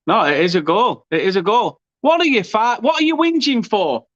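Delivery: real high-pitched disbelief; indignant protest. Real, not acted. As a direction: pitch climbing in exasperation. Commentator argues with protesting players.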